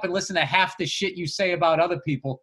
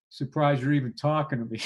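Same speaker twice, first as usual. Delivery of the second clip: low, softened voice; subdued, downcast mock reply; retold dejected reply; 5 semitones lower, 5 dB quieter than usual. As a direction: say it quietly, imitating a wounded friend. Retold hurt comic after roasting.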